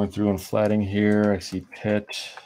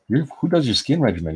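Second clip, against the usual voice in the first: high, louder, in a voice unlike his own; amazed question; 5 semitones higher, 4 dB louder than usual. Retold or imitated. Keenan, stunned by the artist's young skin, asks about the regimen. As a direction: gush it out in disbelief.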